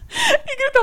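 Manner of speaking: rindo ao contar o grito; laughing. Real, not acted. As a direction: fala entre risadas. Retelling Julia's scream, laughing. (rindo muito)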